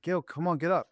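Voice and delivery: dad urging son out of bed; calling to wake. Retold or imitated. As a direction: rouse him from bed. Dad waking son, retold.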